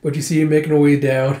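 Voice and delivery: plain reading voice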